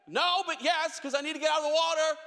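desperate to get out of the water; panicked answer. Retold or imitated.

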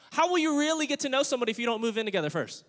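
high, fast mock-reasonable questioning; mock worldly advice. Preacher voicing the world's customs to church, mock persuasion. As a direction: rattle it off high, like a pushy worldly adviser.

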